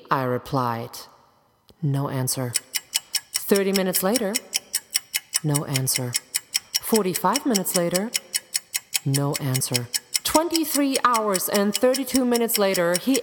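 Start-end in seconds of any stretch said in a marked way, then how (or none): none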